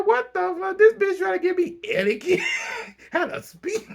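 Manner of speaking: high-pitched mock outrage, cracking up; laughing imitation. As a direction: squeal the indignant quote through laughter. Mocking Lisa while cracking up.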